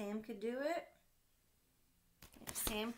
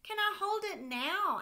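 put-on high, loud pleading child voice; eager little boy; 12 semitones higher, 10 dB louder than usual. Sam begging his dad to hold the kite, high eager child voice. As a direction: beg like a small kid who wants a turn. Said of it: "asked Sam".

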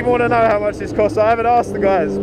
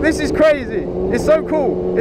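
ordinary talk, nothing marked

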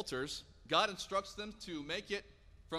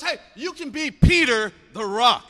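loud, drawn-out, higher-pitched call; rousing proclamation; 4 semitones higher, 16 dB louder, slower than usual. Retold or imitated. Preacher voices Jesus renaming Peter, rousing loud proclamation. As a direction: proclaim it big, stretching the key words.

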